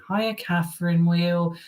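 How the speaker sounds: high, drawn-out sitcom quote; comedy catchphrase. Retold or imitated. Quoting a comedy to the viewers, mock sitcom voice. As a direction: quote the show in a put-on high voice. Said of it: "that comedy".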